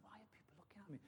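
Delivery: whispered puzzled thought. Retold or imitated. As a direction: whisper it to yourself, baffled.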